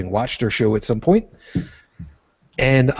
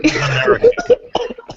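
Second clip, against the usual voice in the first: high laugh; 5 dB louder than usual. Real, not acted. Reacting to the sorry joke.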